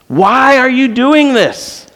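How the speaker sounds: retold yell. Alone in the car, the pastor cries out to God, slow and anguished. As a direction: demand it of God in despair. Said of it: "I was yelling in the car".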